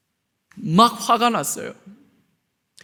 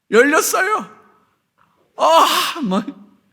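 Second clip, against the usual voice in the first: mock exasperation; 6 semitones higher, much slower than usual. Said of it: "뚜껑 열렸다는 거예요".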